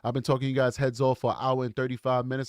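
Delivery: ordinary talk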